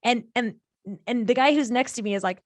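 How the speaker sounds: ordinary delivery, nothing marked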